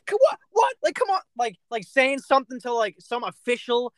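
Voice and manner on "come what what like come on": high, loud mock whine of disbelief; Russell Westbrook whine. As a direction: squeal it like an offended star player. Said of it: "he's like".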